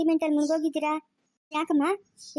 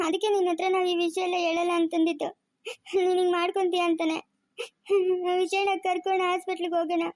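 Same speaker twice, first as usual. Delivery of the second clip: higher voice, crying as she speaks; tearful panic; 4 semitones higher than usual. Acted. Daughter over her fainted mother, crying in panic. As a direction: sob between phrases in distress.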